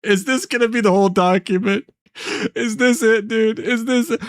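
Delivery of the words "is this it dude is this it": high, whiny fake cry; mock despair. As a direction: plead to the ceiling, hammed-up. Streamer mock-despairs at the document.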